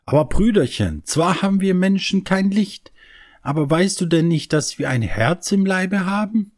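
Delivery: mildly astonished little sister; astonished girl. A narrator's reading. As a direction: Christa amazed he doesn't know. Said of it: "ganz erstaunt".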